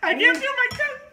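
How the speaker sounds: half laughing, half crying out; laughing through the burn. Real, not acted. Mouth on fire from the food.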